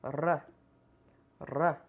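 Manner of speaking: R as a quick tongue tap on the palate; tapped R. Shown as a demonstration. Teacher demonstrates tapped R sound for students. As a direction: flick the tongue once for R. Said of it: "dessa forma".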